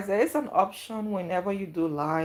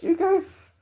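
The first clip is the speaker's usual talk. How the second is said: much higher, strained "You guys"; voice cracking; 12 semitones higher, 6 dB louder than usual. Real, not acted. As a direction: voice jumps up and breaks from emotion. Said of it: "My voice has even going".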